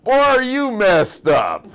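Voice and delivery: very loud, high, drawn-out jeer; incredulous mocking holler. Trucker mocks a lost traveler, loud incredulous holler. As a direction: bellow it in gleeful disbelief, stretching the words. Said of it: "Just like that".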